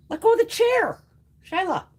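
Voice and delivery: high loud order to the cat; sharp command. Real, not acted. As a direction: make the cat let go right now. Cat clinging to chair.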